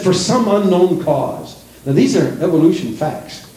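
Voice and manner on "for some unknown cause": mocking quote. Mocking evolution book wording.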